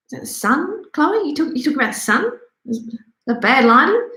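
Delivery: high, incredulous jab at the quote; mocking disbelief. Real, not acted. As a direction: scoff at her excuse, pitch jumping up. Mocking Khloe's statement.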